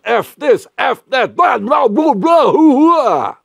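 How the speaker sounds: very high, loud shout of nonsense; building to a wild shouted outburst; mock angry shouting. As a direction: blow up and yell.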